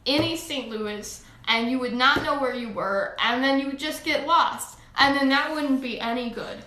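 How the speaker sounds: put-on chatty Saint Louis American accent; playful Midwestern American accent; switched American accent. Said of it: "we're switching accents".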